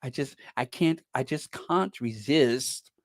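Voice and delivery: soft, breathy, trailing to a whisper; hushed mock-swoon; mock Dan Wooten. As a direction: imitate him swooning, fading out. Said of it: "Dan Wood would say".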